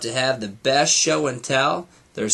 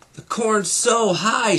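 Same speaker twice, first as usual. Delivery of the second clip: excited cry; 8 semitones higher, slower than usual. A narrator's reading. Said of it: "shouted Morty and Ferdy".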